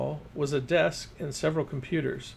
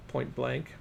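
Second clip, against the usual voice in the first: ordinary delivery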